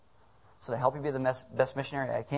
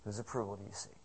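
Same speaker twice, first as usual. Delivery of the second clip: softer repeat; 4 semitones lower, 12 dB quieter, faster than usual. Acted. Pastor repeats the question to congregation.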